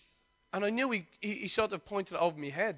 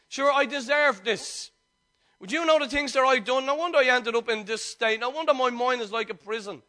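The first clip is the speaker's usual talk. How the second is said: loud, raised-pitch sermon delivery; impassioned preaching; 6 semitones higher, 8 dB louder than usual. Real, not acted. Preacher tells congregation they need not stay in prison, impassioned preaching. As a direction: preach it out forcefully, voicing the listeners' excuses.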